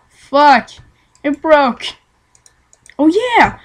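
loud cry of annoyance, then triumph; frustrated outburst. Real, not acted. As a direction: blurt it out at the game. Reacting to game loudly.

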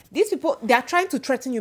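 mild mock furious warning; furious husband. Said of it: "My husband was so furious".

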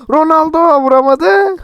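high, slow dismayed call; groaning as Ronaldo misses; excited groan. Real, not acted. Watching Ronaldo miss, a high dismayed call. Missed shot in football game.

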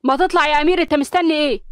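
angry nudge at a hesitant friend; irritated snap. A narrator's reading. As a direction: bark at him to get moving. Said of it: "وقال بنرفزة".

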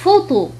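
"فوتو" with PH sounded as f. Shown as a demonstration. Teacher showing learners French PH, clear f in "فوتو". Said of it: "تنطق كحرف اف".

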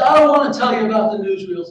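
loud, high youngster's refusal; acted exasperated refusal; son's protest. A narrator's reading. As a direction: protest loudly to pestering parents. Son refuses parents' questions in story.

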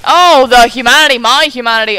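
overacted loud lament over lost humanity. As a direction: bellow it like a stage tragedy. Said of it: "mock melodrama".

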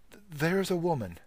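ordinary delivery

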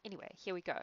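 plain reading voice

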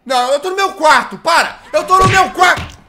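high, loud frantic begging; panicked pleading shout. Real, not acted. Being punished by the nun.

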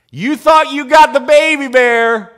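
loud, high acted challenge; shouted taunt. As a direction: shout down an overconfident opponent.

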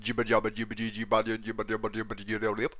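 fast babbled gibberish; mocking gibberish at fast pace. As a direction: rattle off nonsense syllables quickly.

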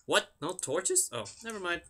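ordinary delivery

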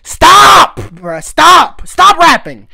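loud, high exasperated shout; shouting stop. Real, not acted. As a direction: yell at him to quit rapping, fed up. Begging the rapper to stop.

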